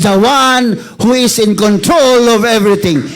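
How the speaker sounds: loud, high shouted preaching; fervent preacher shouting God's sovereignty; full-force preaching. Acted. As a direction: preach it to the rafters.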